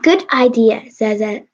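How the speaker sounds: ordinary delivery, nothing marked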